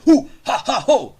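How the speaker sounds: high, loud hoots on the beat; showy mock rapper whoops; rap ad-libs. Shown as a demonstration. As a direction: punch out hoo-ha ad-libs. Rap ad-libs after his boast.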